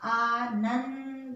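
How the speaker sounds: आनंद drawn out, stressing नं; very slow model word. Shown as a demonstration. Teacher to children: slow Anand to hear the nasal. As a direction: stretch Anand slowly to bring out नं. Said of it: "देखो ना पे सुना".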